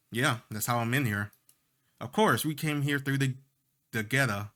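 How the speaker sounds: usual speaking voice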